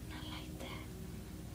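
breathy slow whisper. Pretend. Vlogger teasing viewers, slow secret whisper. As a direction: hush it out slowly like a secret. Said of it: "I'm kidding".

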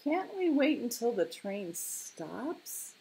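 high, slow, hesitant plea; wavering little tiger question; reluctant. A narrator's reading. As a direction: drag it out, not eager at all. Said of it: "didn't look quite so eager now".

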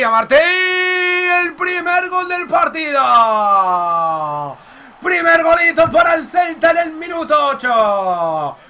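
long drawn-out goal yell, high and loud; stretched triumphant goal call; goal shout. Real, not acted. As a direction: celebrate the goal like a commentator. Celebrating goal in game.

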